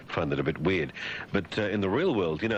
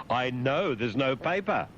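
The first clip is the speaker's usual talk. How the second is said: slow, higher, drawn-out retort; mock shout; 6 semitones higher, slower than usual. Shouting at the fax about paper, mock exasperated shout. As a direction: half-shout it, stretching the words. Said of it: "and I shout, I say".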